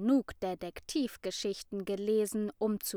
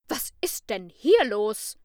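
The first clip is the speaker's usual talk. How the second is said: much louder stern call at normal pitch; teacher calling over noise; 9 dB louder than usual. A narrator's reading. Frau Sander silences the noisy class, loud and stern. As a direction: cut through the din with authority. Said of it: "übertönte plötzlich die Stimme".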